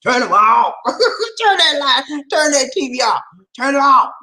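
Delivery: playful high, drawn-out soul-star command; laugh, then belt the order like a soul singer. Acted. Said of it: "in my [name] boy".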